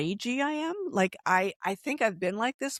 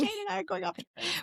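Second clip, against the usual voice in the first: playful high announcement; 12 semitones higher, 5 dB quieter, faster than usual. Pretend. Pretend announcing to family.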